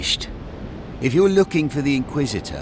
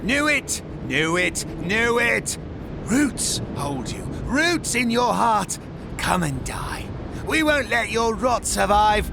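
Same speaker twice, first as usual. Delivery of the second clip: frenzied menace; 7 semitones higher than usual. Acted. Root-maddened figure condemning a traitor to die, frenzied and high. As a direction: cry out the curse like a possessed zealot.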